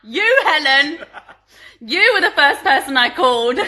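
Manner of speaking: loud, delighted call through laughter; laughing exclamation. Real, not acted. Presenter telling watching friend Helen she called her first, laughing loudly. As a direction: belt it out while cracking up.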